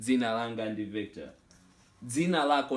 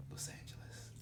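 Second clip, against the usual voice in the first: whispered; 18 dB quieter than usual. Real, not acted. Teacher finishing his example answer, whispered. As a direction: whisper the place name.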